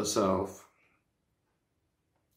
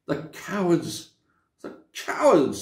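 sobbing; 5 semitones higher than usual. A narrator's reading. Susan watching Aslan bound, mildly tearful. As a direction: say it through sobs. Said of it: "sobbed Susan".

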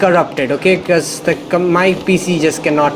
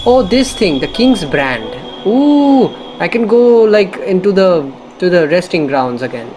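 excited; 3 semitones higher than usual. Real, not acted. Gamer thrilled at finding the King's Brand, excited. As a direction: gush over the new item, voice jumping up.

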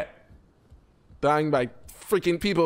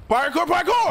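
high excited double yell; parkour shout; 10 semitones higher than usual. Retold or imitated. Gamer jumping again, yelling the catchphrase high. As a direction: chant it as you jump. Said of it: "Call me Michael Scott".

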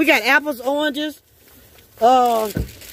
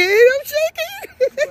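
much higher, slow, shivering "I'm shaking"; shivering from cold; 13 semitones higher, much slower than usual. Real, not acted. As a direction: drag the words out, shivering in the freezing cold.

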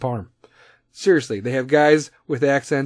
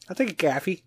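higher, exaggerated Chicago reply; flattened Chicago "coffee", hammed up; Chicago accent; 4 semitones higher, 4 dB quieter, faster than usual. Chicago host stubbornly taking the coffee, mock Chicago accent. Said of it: "you know, Chicago accent".

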